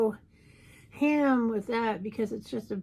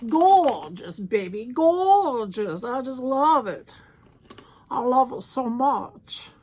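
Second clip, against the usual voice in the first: diva gush; 2 semitones higher, 9 dB louder, slower than usual. Acted. Gushing in a put-on diva voice.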